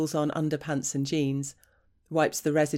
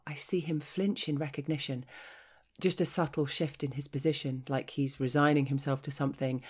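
ordinary delivery, nothing marked

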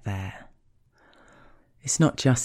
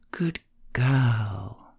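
put-on weight on "girl", held long; stress on second word. Shown as a demonstration. Partner praising the speaker, stress on "girl.". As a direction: lean on "girl." after a shorter "Good". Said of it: "Emphasis on the second word".

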